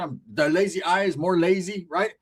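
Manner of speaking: usual speaking voice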